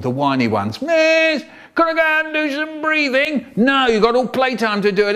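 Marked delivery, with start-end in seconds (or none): 0.8-3.5 put-on high whining child; whiny pupil begging the teacher to breathe; whiny kid. 3.6-5.2 high fast brisk refusal; teacher briskly refusing a pupil. Retold or imitated.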